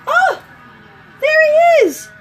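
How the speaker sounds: high, loud delighted call; light up as he walks in; excited greeting. Real, not acted. Mom greeting her son as he wakes up, high and loud with delight.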